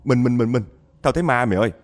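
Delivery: frightened, stammering confession to a friend; shocked and tripping over the words; scared stammering. Acted. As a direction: stutter the first word over and over. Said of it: "nó lắp ba lắp bắp".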